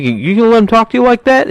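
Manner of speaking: mock mobster goading. De Niro's character goading Tommy, high mock taunt. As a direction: egg him on in a high wiseguy voice. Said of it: "he's like".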